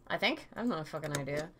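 usual speaking voice

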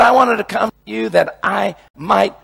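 shouted, high, sarcastic misquote; mock misquote. Preacher mocking a misreading of Romans, shouted and high. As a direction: belt out the wrong version on purpose. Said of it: "No, doesn't say that either".

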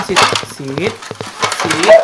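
high, loud snap of an order; sharp command. Real, not acted. Owner snapping sit at Luna, high and loud.

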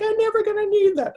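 imitating her younger self in a bratty squeak; mock bratty voice. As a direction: whine it like a sulky schoolkid. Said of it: "I'm like".

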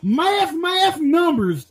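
slow, stretched mocking shout of mass numbers; mock drawn-out shout. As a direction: drag it out and yell it in mockery. Mocking the smart candidate.

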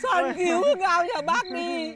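slow, crying plea for help; mother sobbing as she begs Soan to save her son; tearful plea. Acted. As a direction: beg her through tears, slowly.

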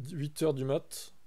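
ordinary delivery

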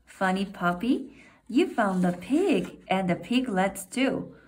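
plain reading voice

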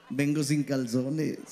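low, very slow mock-sexy murmur; seductor al oído. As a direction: purr it slowly and low into her ear. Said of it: "me la acerco al oído y le digo". (susurro fingido, grave y lento, de galán)

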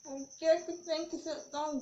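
speaking between bites; chewing while talking. Real, not acted. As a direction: mouth partly full of biscuit. Seen 0: Bites biscuit stick at -5 to -3; closed-mouth chewing continues early on.

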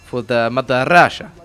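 lightly put-on Russian soldier voice; mutterless, measured delivery with a hint of Russian accent; mock Russian accent. In-character player voice, mock Russian.

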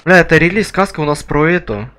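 ordinary delivery

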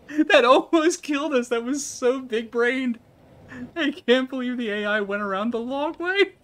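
high-pitched, giddy disbelief; squealing with real amazement; amazed exhilaration. Real, not acted. Amazed after narrowly surviving a play.

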